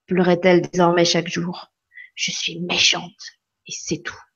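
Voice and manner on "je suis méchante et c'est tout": softer, slow, then breathy whisper; a sad confession trailing off into a whisper; fading to a whisper. A narrator's reading. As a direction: let it die away into a whisper. Refrain sinks into whisper.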